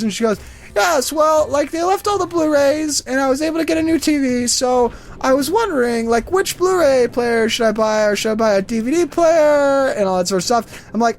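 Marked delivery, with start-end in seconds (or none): 0.7-9.9 high-pitched old lady impression; put-on elderly voice, pitched up; elderly woman. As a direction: mimic an old woman rambling through her questions. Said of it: "it's this old elderly woman".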